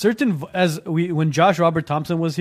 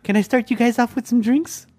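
waitress voice; 6 semitones higher than usual. Retold or imitated. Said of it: "the waitress coming up to you and saying".

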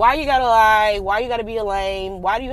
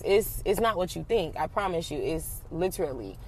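soft pleading; 5 semitones lower, 8 dB quieter than usual. Retold or imitated. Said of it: "like, sis".

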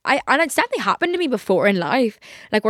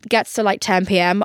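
usual speaking voice

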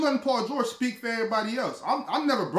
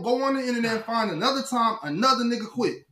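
normal talking voice

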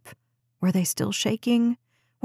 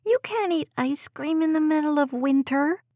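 put-on complaining child; 7 semitones higher than usual. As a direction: drag out the complaint like a kid. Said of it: "Des complained".

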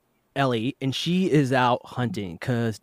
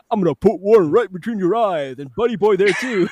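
mock deep voice; 5 semitones higher, 6 dB louder than usual. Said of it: "the deep voice got me".

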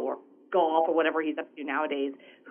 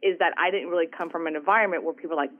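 normal talking voice

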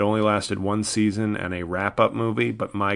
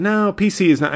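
higher, louder, punchy no; emphatic denial; 9 semitones higher, 5 dB louder than usual. Real, not acted. Rejecting the claim that PC ruins comedy, emphatic and loud. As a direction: slam the claim down hard.

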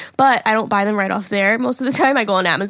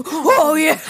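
hammed-up dude voice; higher, louder mock dude; man voice; 6 semitones higher, 4 dB louder than usual.

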